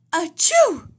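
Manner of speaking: a big sudden sneeze, high and loud. A narrator's reading. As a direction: let the sneeze burst out mid-word. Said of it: "That was quite a sneeze".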